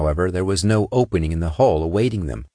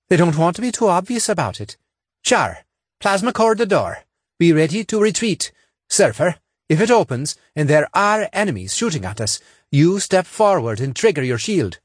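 quick, higher, urgent orders; commanding voice; 10 semitones higher than usual. A narrator's reading. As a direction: squad leader barking quick orders.

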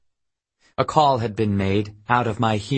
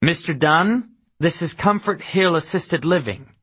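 higher-pitched woman on the phone, lightly voiced; pitched-up, mildly surly phone greeting; 6 semitones higher than usual. A narrator's reading. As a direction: lightly play a surly care-home caller, pitched up. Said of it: "A surly woman's voice".

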